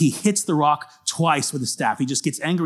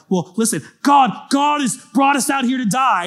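complaining people; 10 semitones higher, 5 dB louder than usual. Retold or imitated.

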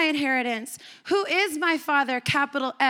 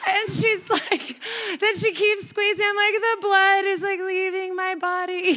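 laughing at the memory of her grip; laughing while telling; 5 semitones higher than usual. Real, not acted. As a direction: tell it while cracking up. Laughing through the story.

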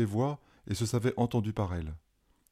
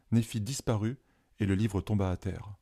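usual speaking voice

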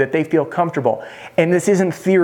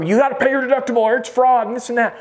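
high-pitched mock scolding; pushy mock objection; 9 semitones higher, faster than usual. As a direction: imitate a salesperson blurting out a warning. Mimics pushy salesperson's wrong response.

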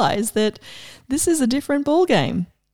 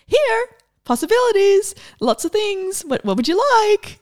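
much higher, bright and inviting; generous universe offering; 10 semitones higher than usual. Pretend. The universe offering her possibilities, high and inviting. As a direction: hold out gifts like a shopkeeper. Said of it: "there's this energy of".

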